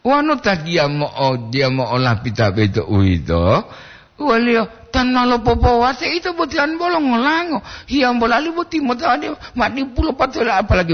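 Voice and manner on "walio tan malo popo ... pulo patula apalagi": sustained, very high-pitched talk; forced high voice. Real, not acted. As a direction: force the whole run up high. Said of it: "Makanya saya paksakan untuk tinggi". (suara dipaksakan tinggi)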